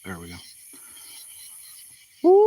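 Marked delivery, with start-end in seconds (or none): 2.2-2.4 coyote howl. Shown as a demonstration. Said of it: "I'm gonna do a coyote howl".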